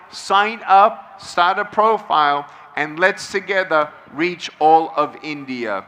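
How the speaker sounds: ordinary talk, nothing marked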